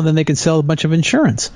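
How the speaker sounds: ordinary delivery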